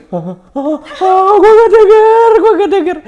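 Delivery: high, drawn-out shout over crowd noise; baffled mock yelling. Fan yelling back in a noisy stadium, baffled mock shout. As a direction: strain to yell that you can't hear. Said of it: "Gue langsung kayak".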